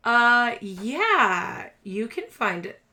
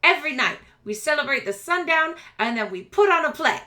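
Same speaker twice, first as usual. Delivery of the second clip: higher, punchy little showman voice; eager rat boy performer; 7 semitones higher, 6 dB louder than usual. Acted. Rat boy telling shoppers about nightly parade, high eager pitch. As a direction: sell the nightly show with energy.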